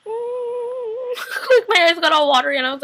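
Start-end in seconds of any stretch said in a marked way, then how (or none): 0.1-0.6 high, drawn-out mock whimper; crying whimper. Viewer reacting to Vicky's return, high crying whimper. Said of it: "crying at this point". 0.7-1.4 high mock sob; crying. Viewer cries over Vicky, high sobbing noise. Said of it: "crying at this point".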